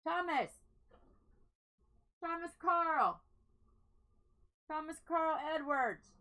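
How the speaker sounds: calling loudly from another room. Real, not acted. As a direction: shout his full name across the house.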